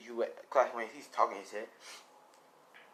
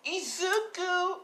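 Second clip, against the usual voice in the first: put-on slow high girl voice; much slower than usual. Girl calls the hero.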